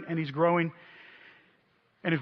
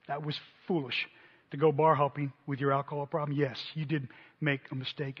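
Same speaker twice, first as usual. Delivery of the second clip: softer, lower, tender words of love; tender reassurance; 3 semitones lower, 5 dB quieter than usual. Retold or imitated. Mentor comforting ashamed disciple, soft and tender. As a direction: hold him close and reassure him. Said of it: "put my arms around him and said".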